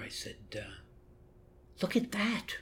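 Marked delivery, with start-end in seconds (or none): 1.8-2.6 high, soft acted astonishment; son addressing mother. Son to his mother watching the video, softly amazed. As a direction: point it out in a high, hushed voice. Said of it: "So I said to my mother".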